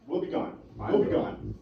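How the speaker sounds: usual speaking voice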